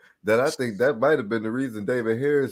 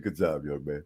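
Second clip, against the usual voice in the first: low, soft condescending pat; patronizing praise; 9 semitones lower, 7 dB quieter than usual. Retold or imitated. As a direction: say it down low like a calm veteran. Quoting Duncan's patronizing praise.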